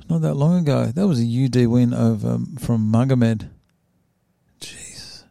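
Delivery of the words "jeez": whispered jeez in awe; breathy awed jeez. Real, not acted. Reading a brutal fight record, whispered jeez. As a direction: let out a hushed jeez. Amazed by tough opponents.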